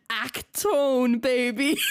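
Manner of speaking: drawn-out American vowels on a German catchphrase; mock American accent. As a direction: exaggerate the American dub's accent. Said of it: "Why does he have an American accent?".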